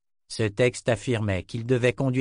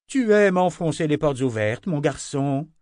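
high, louder exclamation as Anna; exclaiming; 5 semitones higher, 5 dB louder than usual. A narrator's reading. Anna scolds Richard, exclaiming loudly. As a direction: cry it out, exasperated. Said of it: "s'écria-t-elle".

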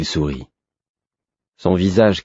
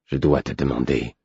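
low, quiet, spiteful; venomous hatred; 5 semitones lower, 4 dB quieter than usual. Acted. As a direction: let the hatred seep through, low. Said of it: "dans son ton perçait une haine fielleuse".

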